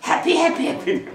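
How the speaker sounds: affectionate calling. Shown as a demonstration. Family calling their dog Happy, high and affectionate. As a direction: call the dog lovingly. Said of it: "애정적인 목소리".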